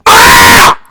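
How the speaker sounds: short loud cry of frustration; frustrated yell. Real, not acted. Fuming student breaks off with a loud frustrated yell. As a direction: let out a sharp yell of anger. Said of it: "I'm fuming right now".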